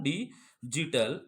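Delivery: Digital stretched very slowly, low and rising; drawn out. Shown as a demonstration. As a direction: drag out digital syllable by syllable. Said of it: "किसकी बात कर रहा हूं?".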